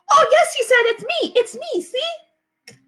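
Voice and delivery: put-on high, loud pleading little-kid voice; insistent toddler. Fudge to his mother about the commercial, high insistent toddler voice. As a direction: insist like a small boy who wants to be believed.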